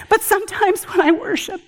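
ordinary talk, nothing marked

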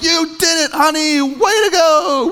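high, drawn-out cheer; hammed-up proud husband's holler; encouraging yell. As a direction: cheer on your wife after the birth. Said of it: "yell something like".

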